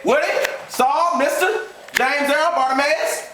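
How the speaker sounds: high-pitched, emphatic address; announcing to brothers. Acted. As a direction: call out the roll, then announce.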